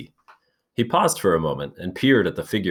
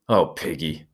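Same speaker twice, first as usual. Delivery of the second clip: a stricken, hushed sigh of a name; low and stricken. A narrator's reading. Ralph to Piggy, low and stricken. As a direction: let the grief weigh it down. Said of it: "Ralph's voice, low and stricken".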